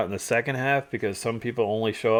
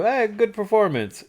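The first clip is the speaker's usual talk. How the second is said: bright greeting; 11 semitones higher than usual. Retold or imitated. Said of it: "and I go".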